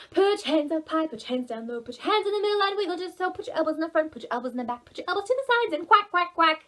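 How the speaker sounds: fast chanted song; rushed high action-song chant. Shown as a demonstration. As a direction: speed up the song for the kids.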